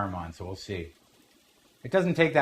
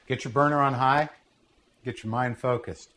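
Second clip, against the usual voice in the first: ordinary talk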